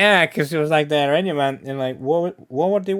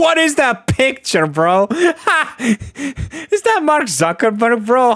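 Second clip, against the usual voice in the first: high, laughing disbelief; 11 semitones higher, 3 dB louder than usual. Real, not acted. Laughing at a photo in the comments, much higher. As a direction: crack up while asking about the picture.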